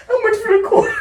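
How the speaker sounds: high squeaky laughing voice; Gary Vee impression. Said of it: "He was like".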